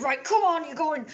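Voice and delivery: high, loud imitation of a bossy guard; mock a guard throwing someone out; guard ordering out. Guard escorting a customer out, high-pitched bossy mimicry.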